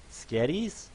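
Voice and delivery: put-on high questioning baby voice; a pony hoping for spaghetti; hopeful fluffy pony. Pony hopes for food.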